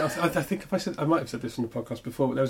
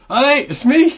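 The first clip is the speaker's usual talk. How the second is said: much higher, louder, laughing mock voice; proud heckler; 13 semitones higher, 10 dB louder, much slower than usual. Heckler greets the performer after the show, laughing mock voice. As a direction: play the chuffed heckler, laughing. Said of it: "he came up to me at the end and goes".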